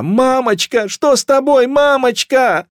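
put-on very high, loud childish wail; distraught little child calling out; frightened child's cry. A narrator's reading. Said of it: "Детский крик резанул по ушам".

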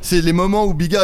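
ordinary talk, nothing marked

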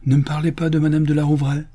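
exclaiming. A narrator's reading. Grandmother protesting about her old friend, exclaiming. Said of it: "s'écria madame Brice".

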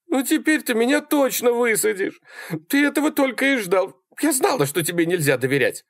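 very high, indignant woman's voice; high, hurt protest; accusing Sally. A narrator's reading. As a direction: accuse in a high-pitched character voice. Sally accuses Malcolm in quarrel.

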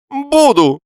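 very high, loud defiant cry; stubborn. A narrator's reading. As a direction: blurt it out like a petulant child. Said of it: "упрямо заявил Лоханкин".

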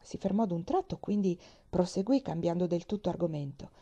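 ordinary delivery

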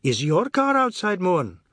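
jovial, high-pitched question; joking, voice lifted high; jocular. A narrator's reading. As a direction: tease him with a lilting question. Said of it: "He asked jocularly".

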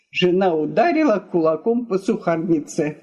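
plain reading voice

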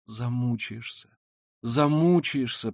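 slow, drawn-out imploring; desperate pleading. Acted. Sonya imploring the killer, in desperate entreaty. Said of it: "в отчаянной мольбе".

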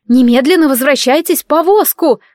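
woman ordering the little dragons inside; ringing command. Acted. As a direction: call the children back sharply. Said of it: "звучно произнесла она".

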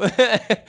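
bright high burst of laughter; real laugh after the punchline. Real, not acted. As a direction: laugh at your own joke. Laughs again.

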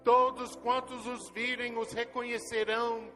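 normal talking voice

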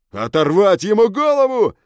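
very high, loud creature shout. A narrator's reading. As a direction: shriek it with glee. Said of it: "предлагал другой".

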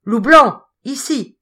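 loud, high call. A narrator's reading. The bear summoning his Indian servant, calling out. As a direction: call him from across the camp. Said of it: "l'ours appela".

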